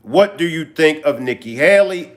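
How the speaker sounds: normal talking voice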